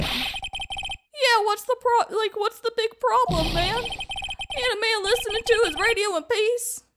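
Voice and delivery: high, indignant southern-Shaggy voice; wandering accent. Acted. Witness protests to judge, high wandering accent. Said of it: "I don't know what happens to his accent".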